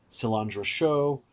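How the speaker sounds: normal talking voice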